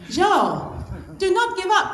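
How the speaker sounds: a French ski instructor's firm urging, lightly imitated; terrible French accent. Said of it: "that was a terrible French accent".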